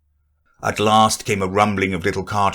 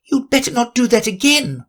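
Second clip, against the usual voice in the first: shouting. Acted. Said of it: "shouted out".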